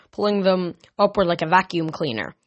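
usual speaking voice

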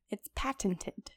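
slow whispered It's patented. Pretend. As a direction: breathe it out in a whisper. Whispered joke repetition.

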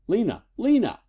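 high-pitched urgent calling, climbing on the repeat; frantic call. A narrator's reading. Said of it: "called Eddie frantically".

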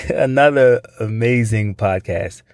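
amazing drawn out slowly, stressed. Shown as a demonstration. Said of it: "every time you use that word amazing".

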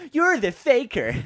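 high, loud, slow shouted line; Sonic shout. Retold or imitated. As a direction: fling the accusation back. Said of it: "And then Sonic goes".

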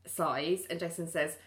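ordinary talk

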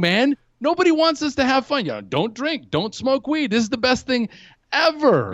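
high-pitched, worked-up protest; acted stoner indignation at the rules; conspiracy ranting. Convinced teen decrying don't drink advice, ranting. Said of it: "start to get these conspiracy theories".

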